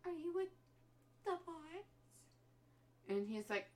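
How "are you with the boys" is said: put-on anxious girlfriend. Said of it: "she's like".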